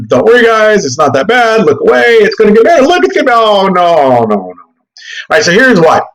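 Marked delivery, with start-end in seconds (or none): 0.1-4.5 high mock reassurance sliding into a wail; hammed-up panic, climbing high then falling away; mock reassurance turning panicky. Mimics false reassurance collapsing into dismay.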